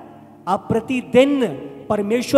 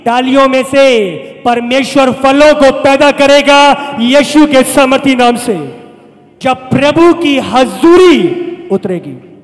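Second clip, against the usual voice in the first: full-force preaching; 9 dB louder than usual. Real, not acted. Preacher promising God will bring fruit from dry branches, full-force preaching. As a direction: belt out each phrase like a revival preacher.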